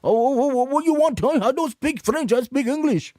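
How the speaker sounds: hammed-up arrogant Brit who refuses to try French; rude English tourist. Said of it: "If you just come and go".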